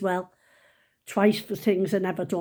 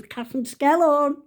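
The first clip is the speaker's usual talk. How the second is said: higher, louder stern summons; teacher calling out; 7 semitones higher, 5 dB louder than usual. Retold or imitated. Teacher to pupil caught not drawing, calling out. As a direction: call a pupil's name across the class. Teacher calls her out by name.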